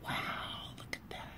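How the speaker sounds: breathy whispered aside. Real, not acted. Reader pointing out the picture, whispered. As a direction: hush it like sharing a secret.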